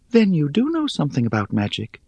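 high, airy, teasing spider; airy little voice. Acted. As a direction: toss it off lightly, amused.

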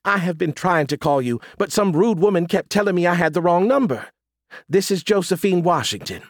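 high-pitched Southern lady; pleasant Southern accent. A narrator's reading. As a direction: chat politely in a high Southern lilt. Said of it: "a very pleasant Southern accent".

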